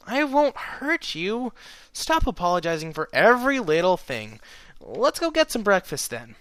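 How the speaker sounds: bright reassurance; chipper mare. A narrator's reading. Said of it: "sounding surprisingly chipper".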